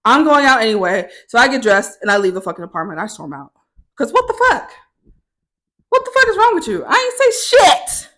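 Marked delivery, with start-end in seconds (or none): 4.0-8.1 very high, incredulous squeal; hammed-up squeaky indignation; squeaky outrage. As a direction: protest in a comically high voice. Replaying outrage at the roommate.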